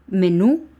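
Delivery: "Menú" stressed on NÚ, loud and rising; stress on last syllable. Shown as a demonstration. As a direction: punch the final ú of "Menú". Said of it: "El tilde va en la U".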